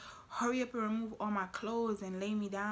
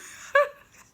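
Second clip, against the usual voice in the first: high burst of laughter; short loud laugh; 12 dB louder than usual. Real, not acted. Laughs after retelling.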